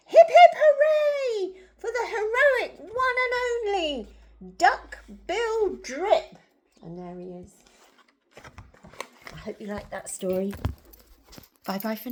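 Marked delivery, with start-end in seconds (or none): 0.1-6.4 high, loud, drawn-out cheer; happy hooting. A narrator's reading. Narrator voices duckbills celebrating their hero, happy hooting. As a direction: jubilant storybook hooray. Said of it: "They hooted".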